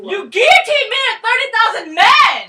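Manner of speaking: high, loud crying yell; anguished shout. Acted. Jacques screaming at his executioner, distraught. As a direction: scream the grievance through tears. Acted quarrel between revolutionaries.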